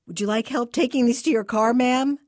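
put-on high, loud, fast nervous teen; a gawky boy whose voice cracks; voice cracking. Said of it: "his voice cracking".

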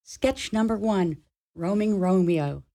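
usual speaking voice

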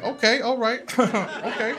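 imitating a rattled preacher placating a heckler; mock nervous pastor. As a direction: laugh it off, trying to recover. Pastor brushing off sister's heckle.

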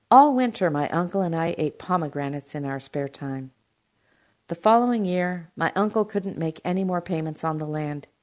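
ordinary delivery, nothing marked

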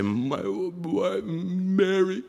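soft, thick-tongued mumbling; swollen tongue. Acted. Patient starting to talk with swollen tongue, soft slurred mumble. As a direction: mumble slowly as if tongue fills the mouth. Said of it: "my tongue was so swollen".

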